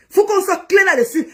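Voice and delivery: high, loud and firm; angry warning. Real, not acted. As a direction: lay down the law, furious. Furious live rant at rival.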